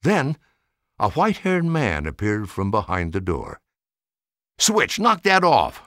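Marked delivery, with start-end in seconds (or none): 4.6-5.8 slow, higher and louder reprimand; scolding. Acted. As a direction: snap the dog to heel. Old man scolds his robot dog.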